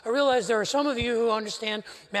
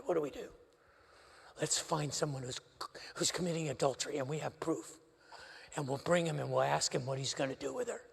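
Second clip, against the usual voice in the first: hushed scheming voice, lower and softer; plotting conspirators; 8 semitones lower, 9 dB quieter, faster than usual. Retold or imitated. Religious leaders quietly scheming to trap the rabbi. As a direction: lean in and plot in a low murmur.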